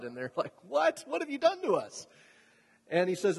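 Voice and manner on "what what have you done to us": high-pitched, alarmed outcry; acted-out incredulous question, pitch leaping up; shocked disbelief. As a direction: demand it like a horrified sailor. Acting out the alarmed sailors' reply.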